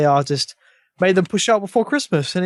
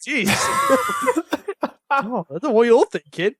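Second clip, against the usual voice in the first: higher, laughing through it; laughing protest; 5 semitones higher than usual. Real, not acted. To the others who laughed at his remark, laughing. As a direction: protest while cracking up.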